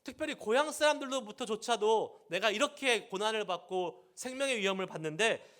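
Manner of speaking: ordinary talk, nothing marked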